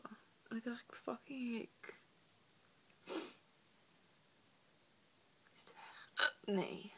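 hushed annoyed whisper; whisper, trying not to wake anyone. Real, not acted.

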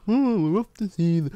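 taunting enemy impression; mocking enemy voice. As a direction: sneer at the enemies in a silly high voice. Mocking the enemies in game.

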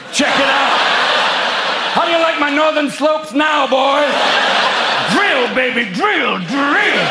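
put-on strut and tease the boys. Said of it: "she'd just be like".

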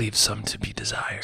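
soft secretive whisper. Real, not acted. Mock secrecy about tips.

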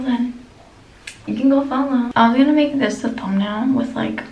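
talking with face mask on; through a sheet mask. Real, not acted. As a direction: mask slightly limits lip movement.

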